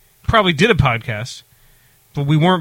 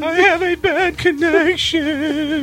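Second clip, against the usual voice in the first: spooky voice; 12 semitones higher, much slower than usual. Pretend. Joining the ghost bit.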